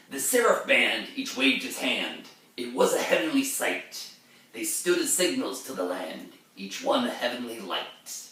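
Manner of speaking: verse recital with a sharp rise on Oh Christ; heightened reading of ballad verse; dramatic poem reading. Acted. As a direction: read it as the haunted mariner.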